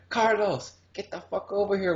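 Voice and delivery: imitated high-pitched holler for someone; mock calling out. Imitating woman calling her husband.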